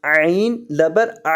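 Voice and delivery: slow, drawn-out Quran teaching chant; syllable-by-syllable spelling drill. Shown as a demonstration. Teacher spelling the verse for students, slow measured recitation. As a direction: stretch each letter name, then join the syllables.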